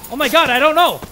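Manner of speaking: high, loud frantic shouting; panicked. Real, not acted. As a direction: panic while running away. Chased by ghost, fleeing.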